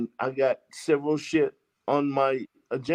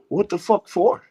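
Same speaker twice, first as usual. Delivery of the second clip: loud, high-pitched angry demand; big mad; 7 semitones higher, 7 dB louder than usual. Retold or imitated. Arrested man demands the reason for his warrant, big mad. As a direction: retell it as an indignant outburst. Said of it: "I bet you was big mad".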